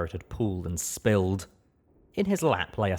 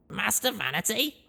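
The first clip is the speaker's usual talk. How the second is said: higher, rising surprised question; young mare voice; 13 semitones higher than usual. Acted. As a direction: Blackjack caught off guard. Blackjack asks, surprised.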